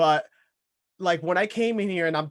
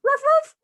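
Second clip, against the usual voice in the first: dog bark imitation; 8 dB louder than usual. Imitating Max the dog barking.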